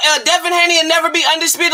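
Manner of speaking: ordinary delivery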